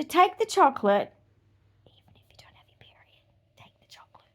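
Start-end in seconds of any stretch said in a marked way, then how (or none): 1.9-4.3 secretive whisper. Acted. Women told to take the chocolate, in a conspiratorial whisper.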